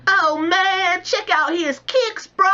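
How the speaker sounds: high, slow dude voice; hyped bro voice. Acted. Friend on the street admiring sneakers, high hyped bro voice. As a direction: gush like an impressed bro.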